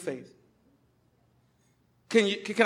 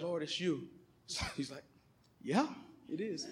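much softer, hushed recognition; awed hush; 4 semitones lower, 15 dB quieter than usual. Retold or imitated. Thomas recognizing Jesus, hushed and much softer. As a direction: barely voice the awe.

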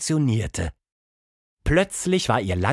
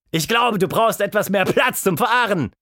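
father's loud, raised shout; bellowing; 9 semitones higher, 5 dB louder than usual. A narrator's reading. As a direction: holler down to the yard. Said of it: "Ihr Vater winkte und brüllte". (brüllend)

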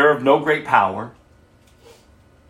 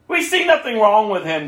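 mildly raised, higher-pitched protest; shouted; 7 semitones higher than usual. A narrator's reading. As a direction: read it as a mild shout from the crowd. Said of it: "they shouted".

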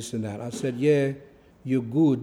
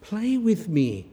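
put-on whiny child; 7 semitones higher, much slower than usual. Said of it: "And then she was playing".

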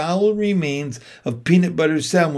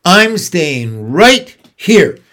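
slow, loud, stubborn rat voice; grumbling rat; 3 semitones higher, 7 dB louder, much slower than usual. A narrator's reading. Rat refuses to go to the fair, grumbling. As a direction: grumble it out, dragging every word. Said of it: "grumbled the rat".

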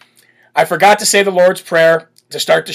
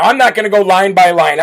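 normal talking voice

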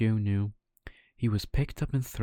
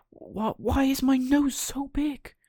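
very high, breathy panicked wail; a puppet terrified of his own nose; cried out; 4 dB louder, slower than usual. Acted. Pinocchio crying out in alarm at his growing nose, high wail. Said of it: "Pinocchio cried out".

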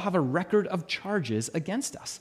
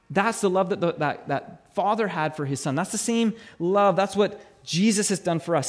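usual speaking voice

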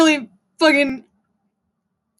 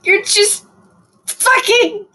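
higher scolding of the doxxers; indignant yell; 6 semitones higher, slower than usual. Real, not acted. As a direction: scold them hotly. Continued indignant rant.

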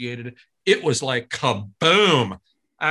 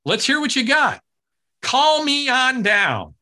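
announcer hype; 9 semitones higher, 4 dB louder than usual. Retold or imitated. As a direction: pump up the studio crowd. Johnny hyping the audience.

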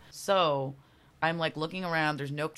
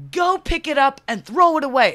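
loud, high mock command; imitated cop order; 11 semitones higher, 15 dB louder than usual. Cop orders her to bin the can, mock shouted command.

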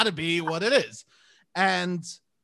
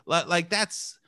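normal talking voice

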